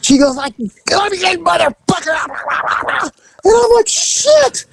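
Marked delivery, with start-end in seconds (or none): 0.9-3.1 high, garbled mock-furious rant; imitated raving rage. Chained woman lunging at the teller, imitated raving fury. 3.9-4.6 very high startled curse; mock fright. Customer seeing her lunge, mock frightened squeal.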